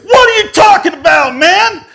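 very high, loud indignant shout; mad retort. Retold or imitated. Accused man shouting back at the buyer, mad. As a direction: yell it back in outraged disbelief. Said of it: "I was mad".

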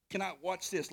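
ordinary talk, nothing marked

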